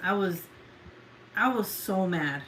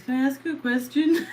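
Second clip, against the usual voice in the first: higher, louder whiny question, hammed up; mimicking nagging students; 6 semitones higher, 6 dB louder than usual. Mom mocking her students' question, louder and higher. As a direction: parrot a pestering student's question in a mock whine. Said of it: "me with my students".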